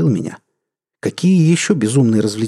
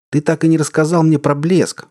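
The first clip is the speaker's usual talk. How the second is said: shouting over noise; 6 semitones higher than usual. Acted. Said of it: "прокричал я".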